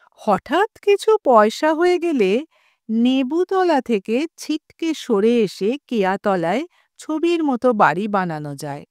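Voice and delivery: ordinary delivery, nothing marked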